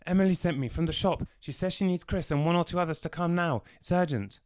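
put-on high, fast and loud child's voice; urgent boy. A narrator's reading. Boy sent from the shop to fetch Chris, fast and high. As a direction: rush it out like a kid who ran the whole way.

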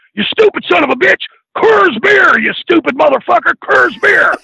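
over-the-top screaming "Coors beer"; mock furious shout. Redneck screaming correction.

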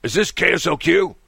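mock caller voice asking a question; imitating the caller. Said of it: "the guy says".

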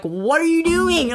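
high, slow and disbelieving; incredulous outburst. Retold or imitated. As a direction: throw it at him, shocked. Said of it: "but I'm like".

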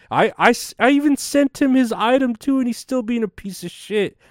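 the voice climbs high with exasperated disbelief; real indignation. Real, not acted. Vlogger venting about the eBay buyer who still insults him, high indignant outburst.

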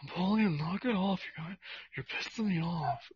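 soft, nasal-free nerdy brother voice; lightly voiced whiny older brother; Kip from Napoleon Dynamite. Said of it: "sounded like Napoleon's brother".

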